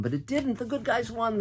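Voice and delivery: high, jubilant cheer of victory; excited. Real, not acted. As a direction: lift it up, a happy little cheer. Joyful exclaim of victory.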